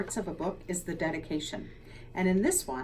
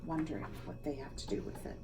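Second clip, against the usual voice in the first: whisper; 5 semitones lower, 12 dB quieter than usual. Real, not acted. Aside about the frog.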